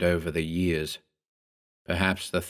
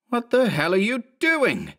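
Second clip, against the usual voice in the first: very high outraged question; high-pitched, indignant demand; angry demand; 14 semitones higher than usual. A narrator's reading. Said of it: "Mark the second demanded".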